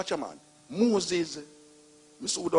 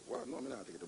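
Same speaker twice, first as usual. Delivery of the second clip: fearful whisper, much softer; whispered refusal; 3 semitones lower, 19 dB quieter, faster than usual. Acted. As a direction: breathe the refusal, scared. Moses refusing, hushed.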